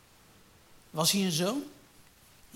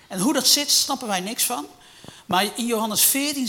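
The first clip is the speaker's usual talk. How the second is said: much higher and louder burst; emphatic preaching; 6 semitones higher, 7 dB louder than usual. Real, not acted. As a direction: cry out the mystery. (luid en hoog uitgeroepen)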